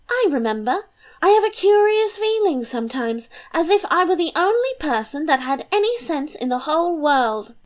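put-on high, bubbly girlish voice; a light, giddy young princess. Princess teasing her papa and mamma, high and bubbly.

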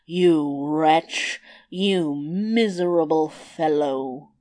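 low, slow, scornful giant voice; contemptuous giant. A narrator's reading. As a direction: sneer it down as a giant. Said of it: "looked at the tailor with contempt".

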